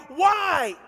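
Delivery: high, drawn-out mock cry; imitated crying out. Preacher voicing a hospital patient's family, high imitated cry. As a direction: cry it out high, acting the anguish. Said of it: "and they're crying out".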